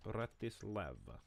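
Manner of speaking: "Bratislava" with a mild Eastern European accent. Shown as a demonstration. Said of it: "You gotta do it in the Eastern European accent".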